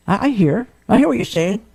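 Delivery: normal talking voice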